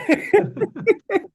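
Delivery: a real burst of high laughter. Real, not acted. Host bursts out laughing at the caller's accent, high laugh. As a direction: laugh out loud at the joke.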